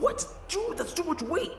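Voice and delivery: very high, loud protest under the load; strained yell. Acted. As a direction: cry out that it's too heavy.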